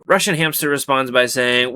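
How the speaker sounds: usual speaking voice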